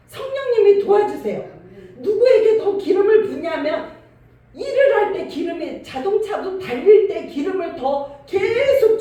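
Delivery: high, loud preaching at full force; fervent preaching. Acted. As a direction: rouse the congregation with fervour. Preaching climax.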